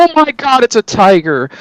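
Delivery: high mock-panicked cry. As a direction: shriek it like a spooked soldier. Mock panicked soldiers quote.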